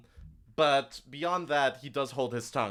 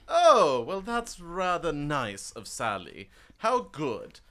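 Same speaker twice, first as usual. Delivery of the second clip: smug NPC; 3 semitones higher, slower than usual. Acted. GM as the smooth official feigning delight at Sally's envoys. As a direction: purr out a fake compliment. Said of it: "He'll nod and go".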